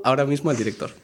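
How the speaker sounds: ordinary talk, nothing marked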